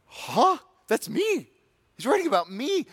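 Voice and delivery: high, startled gasp of recognition; shocked realization. Retold or imitated. The stepmom sinner hearing Paul's letter, high and shocked. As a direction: suddenly realize you're the one named.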